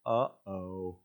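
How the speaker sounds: sheepish uh-oh with a laugh; sheepish cupbearer. Retold or imitated. Cupbearer remembers Joseph, sheepish and laughing. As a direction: caught out, laugh it off. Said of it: "the cupbearer goes".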